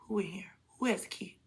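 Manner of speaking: hushed, alarmed whisper. Retold or imitated. Hears the front door open.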